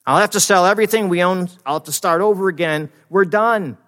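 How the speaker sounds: loud, high doom-saying; acted panic. Breadwinner sure they must sell everything, acted panic. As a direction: declare total ruin, hammed-up. Acts panic over a lost job.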